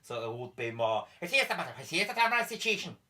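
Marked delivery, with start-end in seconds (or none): 1.2-3.0 higher, sing-song Belfast mumble; mock Northern Irish chatter ending in situation; Belfast accent. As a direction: babble in a Belfast lilt. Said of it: "They say that a lot".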